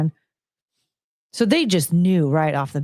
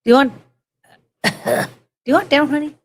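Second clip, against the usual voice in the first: calling to pet; 6 semitones higher, 4 dB louder, much faster than usual. Real, not acted.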